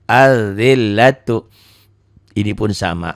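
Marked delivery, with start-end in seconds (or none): 0.1-1.5 Al-zillatu said as az-zillatu, slowly; careful recitation. Shown as a demonstration. Teacher modelling the word for pupils, slow. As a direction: draw out Al-zillatu with a doubled z. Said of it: "ada tasdid do di atasnya".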